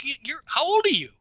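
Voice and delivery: high-pitched, stumbling mock demand; retold interrogation. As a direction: act out grilling a kid about his age. Retold official challenging a kid.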